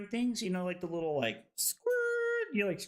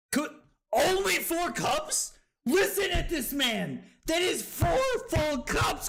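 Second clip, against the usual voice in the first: incredulous; 11 semitones higher than usual. Real, not acted. Incredulous at chat.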